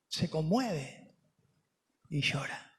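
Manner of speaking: hushed, slow, tender ending. Acted. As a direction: let the words sink gently. Preacher closes on Jesus weeping.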